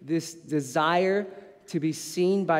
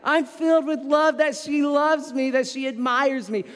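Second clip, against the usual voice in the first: higher, brighter voice of joy; happy and loved; 7 semitones higher than usual. Retold or imitated. Father delighted his daughter loves him, said higher. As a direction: gush with gratitude for a daughter's love. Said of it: "I'm thinking".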